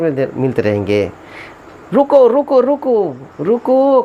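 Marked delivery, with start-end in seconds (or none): 1.9-4.0 urgent higher call to stop. Real, not acted. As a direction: halt the viewer, raised and pleading. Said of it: "काटना नहीं है".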